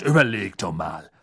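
ordinary delivery, nothing marked

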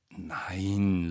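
soft, drawn-out denial; quiet, half-hearted no; dutiful. A narrator's reading. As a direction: say it out of loyalty, unconvinced. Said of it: "sagte Harry pflichtschuldig".

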